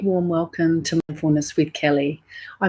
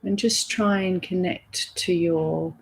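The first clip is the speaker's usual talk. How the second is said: soft, unhurried meditation voice; guided meditation; 5 dB quieter than usual. Real, not acted. Teacher leading listeners through a body scan, guided meditation voice. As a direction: guide them gently inward, softly, with long pauses.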